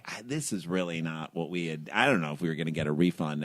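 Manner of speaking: low, soft, hesitant grumble; retold meek complaint. Wife complaining to balloon company for her husband, meek and quiet. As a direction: mimic a reluctant complaint, kept small and quiet.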